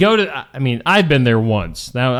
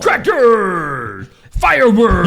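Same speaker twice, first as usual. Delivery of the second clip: hype announcer; 6 semitones higher, 4 dB louder, much slower than usual. Acted. Hyping the tractor pull.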